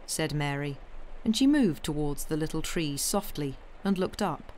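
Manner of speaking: ordinary delivery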